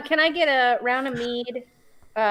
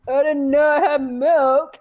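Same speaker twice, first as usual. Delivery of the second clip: loud, slow, higher mock barkeep; imitating changed voice; 4 semitones higher, 7 dB louder, slower than usual. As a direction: mimic the barkeep loudly, drawing it out. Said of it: "And then you were like".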